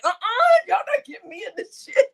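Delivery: high uh-uh, not me; high sassy no way; sassy refusal. Retold or imitated. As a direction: shut it down with attitude. Refusing to get involved.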